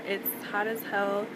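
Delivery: ordinary delivery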